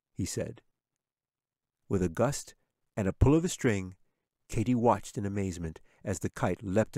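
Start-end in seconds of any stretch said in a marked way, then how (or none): none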